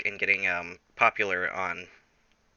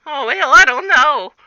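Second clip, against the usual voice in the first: high, loud squeaky put-on voice; squeaky Jack; 12 dB louder than usual. Jack dodges tech support in a high squeak. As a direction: answer in a silly cartoon squeak. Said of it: "And Jack Cooper's like".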